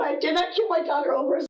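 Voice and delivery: normal talking voice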